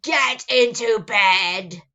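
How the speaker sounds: loud, slow monster roar; thundering order. Acted. Gabe ordering Emma to bed, booming. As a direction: thunder the command. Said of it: "Gabe thundered".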